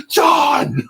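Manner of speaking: a sudden high yell of a name; mock shout. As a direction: yell the name across the circle in mock outrage. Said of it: "the depressives and the people who are more manic are like".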